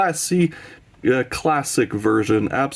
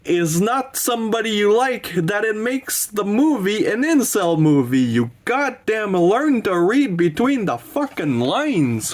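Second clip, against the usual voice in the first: worked-up lecture building to a curse; real angry rant; 8 semitones higher than usual. Real, not acted. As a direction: vent at viewers who misread the film. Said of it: "sorry, I got angry".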